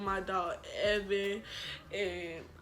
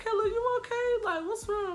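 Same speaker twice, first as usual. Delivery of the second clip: very high imitated mother's voice; worried mom; 13 semitones higher, 3 dB louder than usual. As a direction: fuss over your child in a high voice.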